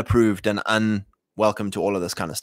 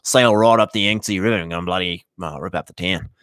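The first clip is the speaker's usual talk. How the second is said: put-on bogan Australian accent; 3 semitones lower than usual. Bogans boasting about sailing up the Yangtze, in a broad Aussie accent. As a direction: lay the bogan Aussie on thick. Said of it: "what's my Australian? Oh, bloody bogan".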